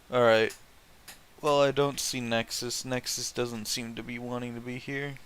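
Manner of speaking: normal talking voice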